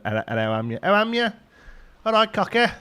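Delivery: high, loud comic regional British greeting; regional British accent. Acted. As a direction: call it out in a broad British dialect.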